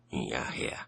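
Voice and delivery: low, obedient reply; old servant. A narrator's reading. Albert answers his master, low and dutiful.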